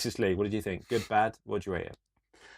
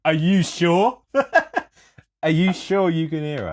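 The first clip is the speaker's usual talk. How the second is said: loud, high, laughing question; incredulous, laughing and louder; laughing disbelief; 8 semitones higher, 9 dB louder than usual. Real, not acted. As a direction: ask through bursts of laughter. Mocking Siri's reply, laughing.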